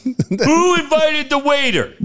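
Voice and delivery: high, slow, drawn-out taunt; mock heckle. Waiter quotes the buddy's insult back, mock yell. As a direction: throw the heckle back in a high mocking call.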